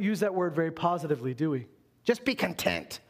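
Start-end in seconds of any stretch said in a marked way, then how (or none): 2.0-3.0 a hint of a scolding mom; mildly stern, motherly; mom's scolding. Mom telling a picky child at dinner, lightly stern. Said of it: "something your mom says".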